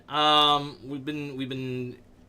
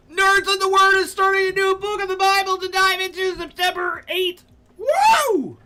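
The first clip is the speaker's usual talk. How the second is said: much higher, louder, hyped reading; hyped announcer; 6 dB louder than usual. Pretend. Streamer reading the server announcement aloud, hyped and high. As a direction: read it like a hype announcer.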